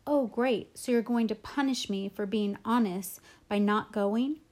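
usual speaking voice

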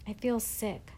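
plain reading voice

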